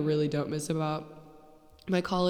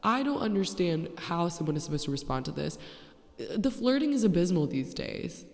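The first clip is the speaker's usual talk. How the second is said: usual speaking voice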